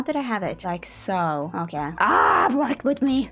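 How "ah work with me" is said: high, loud burst of frustration; loud frustrated plea; exasperated. Real, not acted. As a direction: cry out, then plead in frustration. Pleads with stubborn game pieces.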